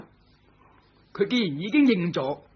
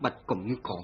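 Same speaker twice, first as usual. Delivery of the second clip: ordinary talk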